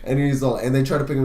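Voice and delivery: ordinary talk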